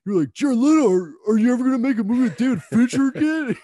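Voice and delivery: very high, loud whiny mimicry; mocking imitation. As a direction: parody his brother's jab in a shrill mock voice. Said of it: "He's like".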